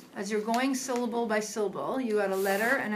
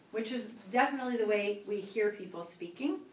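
normal talking voice

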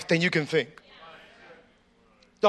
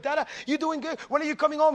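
put-on kids asking dad; 6 semitones higher, 4 dB quieter, faster than usual. Preacher voicing small kids on FaceTime.

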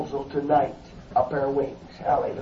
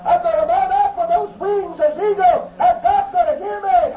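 much higher, ringing cry of resolve; exultant shout; 12 semitones higher than usual. Retold or imitated. Preacher voicing David mounting up on wings, exultant high shout. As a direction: shout David's vow to the rafters. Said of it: "David said".

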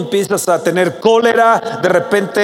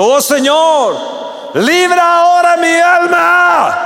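much higher, louder, slow cry for deliverance; crying out to God; 10 semitones higher, 5 dB louder, slower than usual. Acted. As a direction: shout it as a desperate plea to the Lord. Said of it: "decir ese grito". (grito fuerte y agudo de súplica al Señor)

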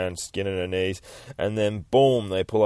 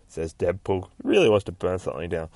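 plain reading voice

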